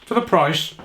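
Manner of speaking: talking through a mouthful; words round a bite of pizza; mouth full. Real, not acted. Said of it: "sorry, talking my mouth full".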